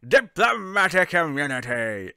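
slow, loud South African villain bark. Retold or imitated. Mocking the Lethal Weapon villain, slow loud South African accent. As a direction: ham up a South African movie villain shouting. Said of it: "who walks around going".

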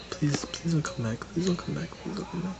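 very soft pleading under breath; hushed pleading. Real, not acted. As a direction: beg quietly not to be found. Hiding, pleading hunter away.

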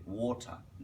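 "ওয়াটার" drawn out with a rounded "wo"; slow model "ওয়াটার" with an "aw" first vowel. Shown as a demonstration. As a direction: say "ওয়াটার" as "WAW-tha", vowel like "or". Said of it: "ওর মতো হবে".

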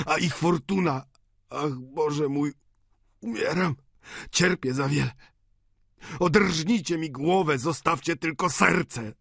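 high-pitched agonized lament; a dying old man crying out in pain; gwałtowna skarga. A narrator's reading. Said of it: "gwałtownością skarg i krzyków starego". (bolesna, podniesiona skarga umierającego)

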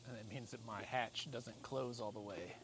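ordinary talk, nothing marked

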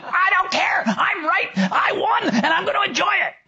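hammed-up, high-pitched defiant yell; defiant shouting. Woman answering her inner voice after winning, mock defiant shout. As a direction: yell it back in mock tantrum.

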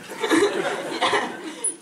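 breaking into a giggle; giggling at the line. Real, not acted. Laughs while reading the scene.

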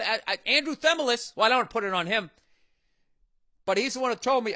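ordinary talk, nothing marked

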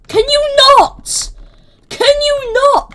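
very high, loud and drawn out; slow, high-pitched indignant yell; exasperated shout. Real, not acted. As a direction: squeal in protest at your attacker. Protesting at being attacked.

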